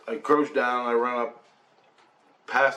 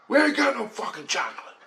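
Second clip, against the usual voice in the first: high, strained mock yell; hammed-up exasperated outburst; imitated angry refusal; 12 semitones higher than usual. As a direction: play the irate vendor, pitch shooting up. Angry ice-cream man refuses.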